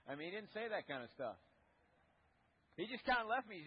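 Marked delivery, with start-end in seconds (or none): none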